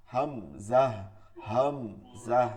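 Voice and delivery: "হামজা" low and split into two firm beats; low deliberate "হামজা"; hard grave hamza. Shown as a demonstration. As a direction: pronounce "হামজা" slowly, low and solemn. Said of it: "শক্ত এবং গম্ভীর আওয়াজ".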